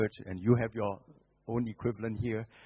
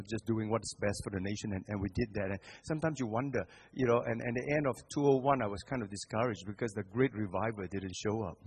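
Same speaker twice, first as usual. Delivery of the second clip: ordinary delivery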